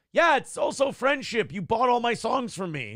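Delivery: high-pitched mock indignation. Betrayed friend answering [name]'s business excuse, indignant. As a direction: argue back loudly, hurt and exasperated. Acted quarrel, [name] answering back.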